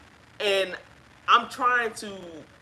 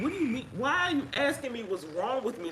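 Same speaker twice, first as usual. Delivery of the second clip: a higher, disbelieving reply; incredulous retort; 6 semitones higher than usual. Retold or imitated. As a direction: throw his question back at him in disbelief. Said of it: "And I said".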